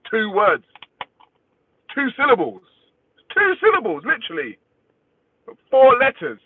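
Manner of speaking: high-pitched, exasperated counting off; exasperated insistence. Real, not acted. Exasperated at people misspelling the name.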